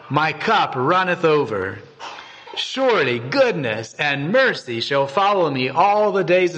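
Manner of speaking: measured, solemn Bible reading; scripture reading. Acted. Reading Psalm 23 aloud.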